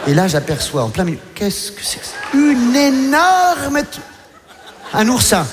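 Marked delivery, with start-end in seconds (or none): 1.3-2.1 hushed, breathy whisper of disbelief. Acted. Comic whispering to himself at the shocking discovery. 2.3-4.0 slow, dragged-out start, cut short; drawn out. Acted. Comic stunned, slowly describing what he sees. Stunned pause before the word.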